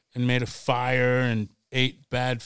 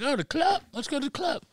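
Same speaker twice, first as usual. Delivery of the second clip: mock eager excitement; 12 semitones higher, 4 dB quieter, faster than usual. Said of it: "I was like".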